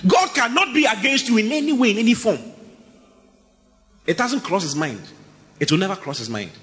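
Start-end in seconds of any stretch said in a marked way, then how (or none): 0.1-2.4 higher, emphatic declaration; fervent sermon peak; preaching. Real, not acted. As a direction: proclaim it firmly.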